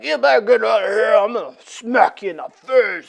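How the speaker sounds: Thing threat. Acted. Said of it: "the thing's like".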